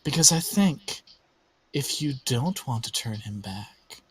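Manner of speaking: soft, insinuating villain voice; coaxing villain. Acted. Villain tempting the player to bargain for the child, soft coaxing. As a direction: coax softly, with pauses between phrases.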